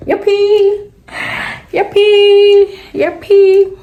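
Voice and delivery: gleeful high-pitched cheer; excited cheer. Real, not acted.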